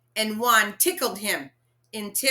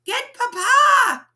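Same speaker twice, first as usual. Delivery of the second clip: high, loud and slow urgent order; frantic shout; 11 dB louder, slower than usual. A narrator's reading. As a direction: shout for help in a panic. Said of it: "Mama cried".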